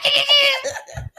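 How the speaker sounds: high shrieking monkey hoot; chimp hooting. Pretend. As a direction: screech like an angry chimp. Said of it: "Do the woke monkey again".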